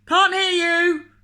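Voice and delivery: loud, high, slow bellow. Acted. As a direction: bellow it back. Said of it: "bellowed the greengrocer".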